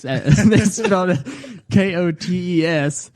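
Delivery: laughing, drawn-out Southern Indiana twang; Southern Indiana accent. Retold or imitated. Host mocking the spelling, in a Southern Indiana accent. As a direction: spell it out slow with a Hoosier drawl. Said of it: "no way that you could read that and not say it in that type of accent".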